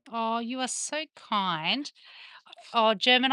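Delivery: ordinary talk, nothing marked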